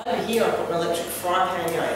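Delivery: ordinary talk, nothing marked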